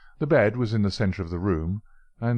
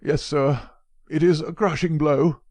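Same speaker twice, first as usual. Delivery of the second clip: put-on higher and louder, a grieving old man; lamenting old professor; 7 semitones higher, 5 dB louder than usual. Old professor to Holmes about the murder, higher lament. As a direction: wring your hands over the loss of the young man. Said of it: "said the old man".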